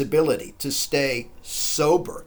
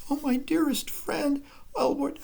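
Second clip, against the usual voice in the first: anguished; 10 semitones higher than usual. Acted. Lovborg mocks his lost friend.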